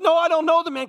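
mock scared denial. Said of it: "That's how scared he was".

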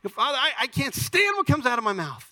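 high-pitched mock exasperation; acted outburst of self-frustration; exasperated confessor. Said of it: "If you say".

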